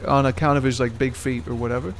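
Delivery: ordinary talk, nothing marked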